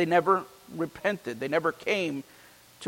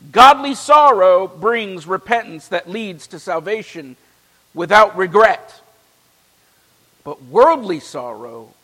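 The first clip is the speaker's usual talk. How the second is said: raised, ringing preacher's reading of the letter; scripture reading; 4 semitones higher, 9 dB louder than usual. Acted. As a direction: proclaim the scripture from the pulpit.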